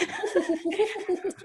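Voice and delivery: high, prim royal titter; Queen laugh. Shown as a demonstration. Host demonstrating the Queen's laugh for the group. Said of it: "like the Queen laugh".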